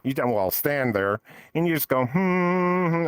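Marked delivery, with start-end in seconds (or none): 2.0-3.1 a short sustained hum; demonstrated humming. Shown as a demonstration. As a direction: hum it as a demo. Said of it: "and you start humming".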